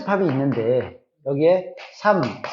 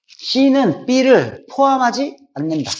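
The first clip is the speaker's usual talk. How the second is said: slow, raised model sentence; slow reading aloud; 6 semitones higher, slower than usual. Shown as a demonstration. Teacher to students, showing how to read it, slow and raised. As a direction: read it out deliberately for the class. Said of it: "이렇게 읽어주면 돼요".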